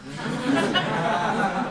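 a soft, breathy chuckle at his own joke; amused little laugh. Real, not acted. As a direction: laugh quietly to yourself.

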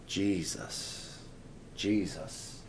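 low, hushed, unhurried utterance of the name; soft, reverent murmur. Shown as a demonstration. Preacher showing what Mom did not say, softly reverent. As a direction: say it gently, as if in quiet prayer. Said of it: "the next thing I heard was not".

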